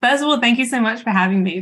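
ordinary talk

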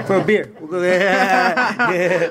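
can't stop laughing at the joke; laughing it off with a rival. Real, not acted. Laughing after coffee joke.

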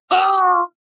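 long, high mock moan; imitated male moan. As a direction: moan loudly as an exaggerated impression. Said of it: "I was having sex and".

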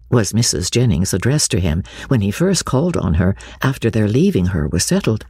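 ordinary delivery, nothing marked

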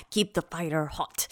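low, slow, soft mock grandma line; imitating Omi. Mimicking Omi to co-host, low and slow. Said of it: "She was all".

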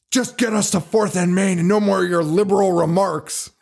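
imitated army general. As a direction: give the order as a hammed-up general. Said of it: "then the general goes".

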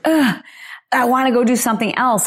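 high whiny ugh of restlessness; mock restless whine; whiny groan. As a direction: moan like you can't sit still a second longer. Inner voice of restless urge.

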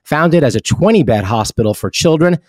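even, polished ad read; host switching into a hospital sponsor announcement. Acted.

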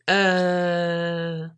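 low, drawn-out mock hesitation; sheepish stalling. Staff asked by paramedics who's in charge, sheepishly stalling. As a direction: stall with a long, droning uh. Imagined unlicensed staff caught out.

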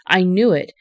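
ordinary delivery, nothing marked

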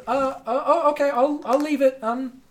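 high hesitant female voice; high flustered guardswoman; flustered guard. Acted. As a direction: stumble over it, caught off guard. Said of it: "She says".

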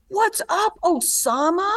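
high, jeering put-on voice of a rude young guy. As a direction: sneer it out like a heckler at a stoplight. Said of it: "leans out and goes".

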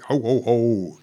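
lightly voiced jolly Santa chuckle; mock Santa. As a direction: ham up a Santa ho ho ho. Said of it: "giving it all that".

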